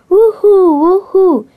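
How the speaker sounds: high, loud, gleeful woohoo; happy whooping. A narrator's reading. Blue happily riding on a fish, whooping. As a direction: whoop with joy, pitch swooping. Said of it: "He was happily riding on a fish".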